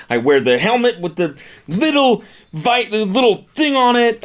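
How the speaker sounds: very high, whiny indignant voice; hammed-up squealing protest; mocking the guy. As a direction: play him as a squeaky offended manchild. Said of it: "I'm making fun of this guy".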